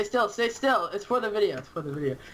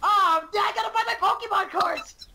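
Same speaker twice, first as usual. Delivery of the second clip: excited shout; 11 semitones higher than usual. Pretend. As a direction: shout it like a giddy kid. Joking about buying cards.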